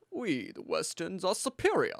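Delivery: hammed-up accent with swooping pitch; cheesy, exaggerated accent of the superior Westerner; mock superior accent. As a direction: put on a self-important fake accent. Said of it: "that's the wrong accent. It's more like".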